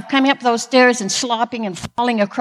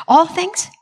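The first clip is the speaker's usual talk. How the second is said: disbelieving question, pitched up; can this really mean all; incredulous; 6 semitones higher than usual. Real, not acted. Questioning the verse.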